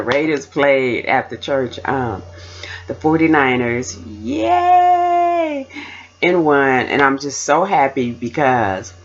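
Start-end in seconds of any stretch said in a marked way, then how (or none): 4.0-5.7 high, drawn-out yay; excited cheer. Real, not acted. Fan reporting her team's win, high gleeful cheer. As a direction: hold the cheer long on one high note. Cheers for the forty-niners winning.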